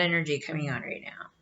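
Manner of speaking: hushed, quick unvoiced whisper. Real, not acted. Reader to viewer, sensing sad energy, whispered. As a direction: breathe it out as if sensing something heavy.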